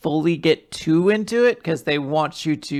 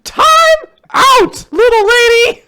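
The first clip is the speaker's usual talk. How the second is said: much higher, louder mock scold; mock scolding parent; 10 dB louder than usual. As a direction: play a fed-up parent. Hosts riff as scolding parents.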